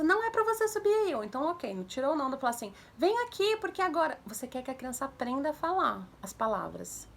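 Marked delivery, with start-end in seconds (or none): 0.0-1.1 high, loud parental scolding; lecturing a child. Shown as a demonstration. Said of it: "dando um sermão na criança". 3.0-4.2 high-pitched summons with a scolding edge; parent calling child. Shown as a demonstration.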